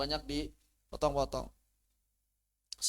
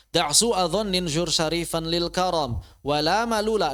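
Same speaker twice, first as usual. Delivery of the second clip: louder metrical Arabic recitation; verse recitation; 2 semitones higher, 8 dB louder than usual. Real, not acted. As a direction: recite the verse firmly and rhythmically. Recites the Arabic didactic poem.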